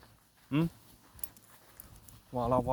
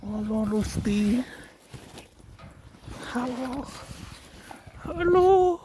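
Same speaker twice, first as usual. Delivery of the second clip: high pet-talk voice; 9 semitones higher, slower than usual. Real, not acted. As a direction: coo up high at the animal. Greeting the animal.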